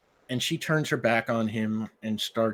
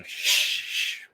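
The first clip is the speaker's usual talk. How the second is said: unvoiced shushing; hushing the dog; 3 dB louder than usual. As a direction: soothe a growling dog. Calming a growling dog.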